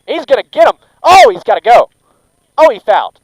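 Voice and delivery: high, loud excited commentary; excited shout. Real, not acted. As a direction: yell as the pitch comes in. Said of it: "I get a little too excited and might scream".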